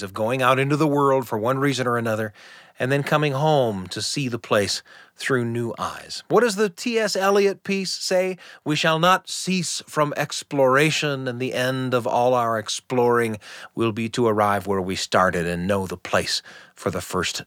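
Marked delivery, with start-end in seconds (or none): none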